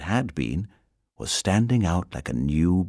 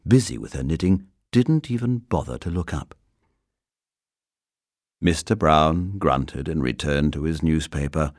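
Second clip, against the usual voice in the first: plain reading voice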